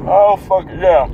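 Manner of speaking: drowsy mid-yawn groan; half-asleep, yawning words; sleepy. Real, not acted. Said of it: "you sound really awake there".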